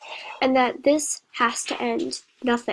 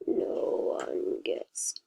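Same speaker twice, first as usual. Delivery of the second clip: creepy whisper; 8 dB quieter, much slower than usual. A narrator's reading. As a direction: breathe it out like a monster. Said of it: "like a disturbing robot".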